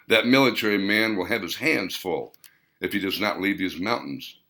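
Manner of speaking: plain reading voice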